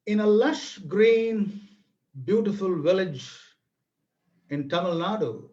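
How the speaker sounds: unhurried folk-tale narration with a little theatrics; story narration. A narrator's reading. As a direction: draw the listeners into an old village tale. Presenter narrates a Tamil folk tale.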